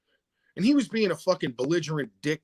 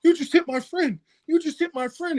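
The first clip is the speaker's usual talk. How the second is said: high-pitched, worked-up mimicry; mock agitated friend; 13 semitones higher, 4 dB louder than usual. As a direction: imitate a panicking sidekick, voice jumping way up. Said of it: "He started jumping up and down".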